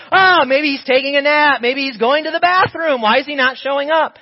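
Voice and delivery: high, loud mocking jeer at the silent god; mocking taunt. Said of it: "And Elijah's like".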